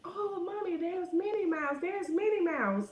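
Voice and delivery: put-on high, excited little girl voice. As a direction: play the little girl tugging at her mom. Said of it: "this little girl was like".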